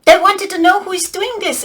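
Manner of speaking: ordinary delivery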